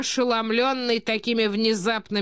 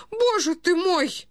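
high, slow lament; poor old prince; 11 semitones higher, slower than usual. A narrator's reading. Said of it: "говорил бедный князь". (бедный князь, высоко и жалобно)

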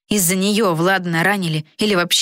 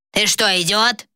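snapped; 3 semitones higher than usual. A narrator's reading. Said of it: "огрызнулся король".

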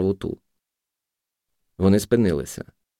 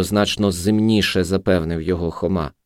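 usual speaking voice

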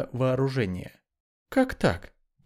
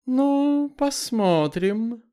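haughty; 8 semitones higher, 3 dB louder, much slower than usual. Acted. Haughty parting threat.

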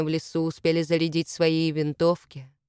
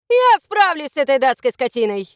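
loud, raised-pitch boast; defiant shout; 5 semitones higher, 6 dB louder than usual. A narrator's reading. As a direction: shout it back with bravado. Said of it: "крикнул Кристофер".

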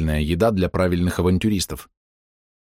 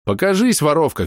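higher-pitched calling out; raised-voice command; shouted demand; 8 semitones higher than usual. A narrator's reading. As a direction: call the thief out, pitch raised. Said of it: "крикнул я".

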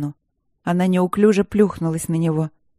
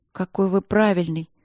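ordinary delivery

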